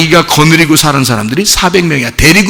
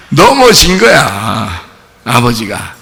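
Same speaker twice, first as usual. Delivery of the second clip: ordinary delivery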